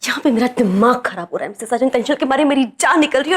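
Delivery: usual speaking voice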